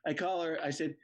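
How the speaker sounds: ordinary delivery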